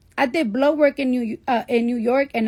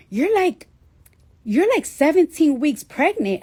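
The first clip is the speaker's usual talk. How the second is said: doctor breaking news; 5 semitones higher than usual. Retold or imitated. Doctor's news voiced high.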